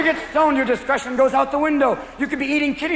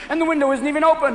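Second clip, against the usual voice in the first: normal talking voice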